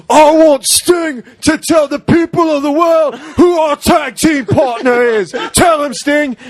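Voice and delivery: high shouted promo, imitating; hammed-up wrestling promo yell; mock announcement to the crowd; imitating Davey Boy Smith. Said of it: "Davey Boy Smith is just like".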